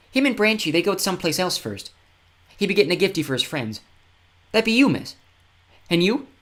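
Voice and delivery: put-on high, rough-spoken fen boy; scruffy young boy. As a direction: tell it like a grubby little kid. Tyke voice again.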